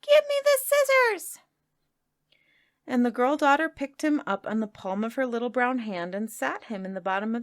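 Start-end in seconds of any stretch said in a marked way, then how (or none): 0.0-1.4 very high, tiny squeaky cry; tiny crab voice. A narrator's reading. Little crab cries to the girl, tiny high squeak. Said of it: "crying in a very small voice".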